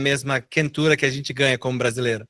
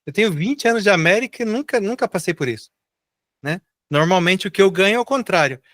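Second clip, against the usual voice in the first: quoting own comment; 8 semitones higher than usual. Retold or imitated. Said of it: "fiz um comentário falando assim".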